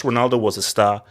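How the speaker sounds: usual speaking voice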